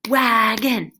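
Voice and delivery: "Wagon" in two strong, separate beats; clapped syllables. Shown as a demonstration. Teacher claps the word out, "Wagon" in two beats. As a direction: punch both halves of "Wagon" with a clap. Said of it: "Clap it".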